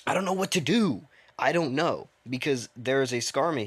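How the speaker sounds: loud, high cry of helplessness; flustered gamer yelling in panic; panicked shout. Real, not acted. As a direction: shout it out, overwhelmed by the game. Panicking during the game.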